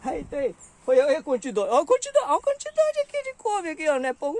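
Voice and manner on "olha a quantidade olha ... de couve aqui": excited showing off. Real, not acted. Showing the amount of kale.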